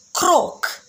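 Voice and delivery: croaky frog word; frog croak. Shown as a demonstration.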